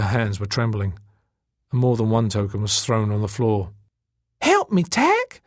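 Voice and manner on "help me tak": very high, urgent woman's voice; excited plea. A narrator's reading. Elena asks Tak to help gather tokens, high and excited. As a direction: call for help in a high flutter.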